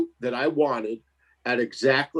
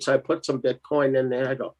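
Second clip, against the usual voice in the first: usual speaking voice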